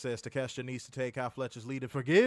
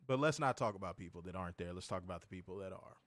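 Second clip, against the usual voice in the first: ordinary delivery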